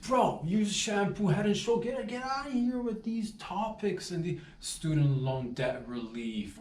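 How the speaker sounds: high, dismissive brush-off; dismissive boss. Retold or imitated. Boss brushing off student loan idea, high mocking. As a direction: wave it off like it's a joke. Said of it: "I'm like".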